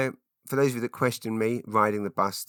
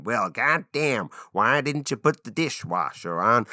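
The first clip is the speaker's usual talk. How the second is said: hammed-up North American accent, pitched up; clumsy, overdone American accent, a bit louder; bad American accent; 4 semitones higher, 4 dB louder, slower than usual. Shown as a demonstration. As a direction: attempt an exaggerated American drawl, slowing the first words. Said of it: "trying to do a North American accent".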